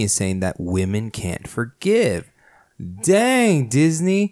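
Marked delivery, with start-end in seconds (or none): 3.0-4.3 mock outrage.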